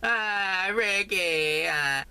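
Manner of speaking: hammed-up sitcom wife crying; Lucy wail. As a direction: cry out like Lucy to Ricky. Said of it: "I sound like Lucy".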